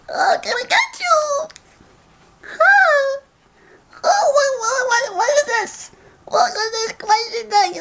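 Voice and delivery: unhinged villain, high and wild; crazed cackling villain voice; crazy voice. Acted. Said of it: "with a crazy voice".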